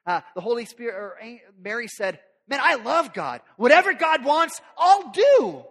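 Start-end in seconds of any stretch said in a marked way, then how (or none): 2.5-5.6 loud, high, eager devotion. Acted. Mary telling God she'll do anything, loud and high. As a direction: burst out with eager yes.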